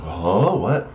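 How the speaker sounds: high, bewildered voice of a lost opponent; baffled opponent. Retold or imitated. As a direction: play the confused player who can't follow. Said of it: "your opponent is sitting there going".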